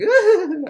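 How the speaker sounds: high, loud villain cackle; evil cackle. Retold or imitated. Guard Muncie cackling after breaking an inmate, evil cackle. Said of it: "He's like".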